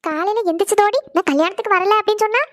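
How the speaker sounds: ordinary delivery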